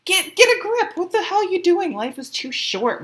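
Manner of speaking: high, loud, exasperated mock shouting; acted scolding universe. The universe shaking someone to wake up, acted shouted scolding. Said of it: "this is the universe going".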